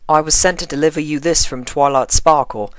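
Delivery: put-on a nervous young officer keeping it proper; polite colt officer checking her name; formal young constable.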